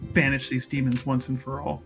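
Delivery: ordinary delivery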